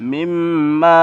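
মিম্মা held long and slow, nasal hum on the doubled মিম; slow chanted মিম্মা; ghunna on mim. Shown as a demonstration. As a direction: stretch the মিম in মিম্মা with a slow falling close. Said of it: "অনুসারে উচ্চারণ".

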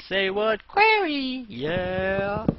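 high-pitched, hammed-up cheer; playful, very high burst of excitement; mock hype. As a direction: squeal it like an over-the-top hype man. Mock hype about wanting a quarry.

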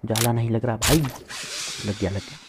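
ordinary delivery

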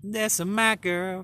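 slow doting cat praise; fond praise. Real, not acted. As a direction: draw it out lovingly.

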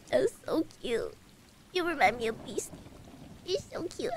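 soft, melting murmur; hushed gushing; overcome cooing. Real, not acted. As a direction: coo softly, overwhelmed by cuteness. Cooing at cute cat.

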